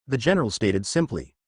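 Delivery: ordinary delivery, nothing marked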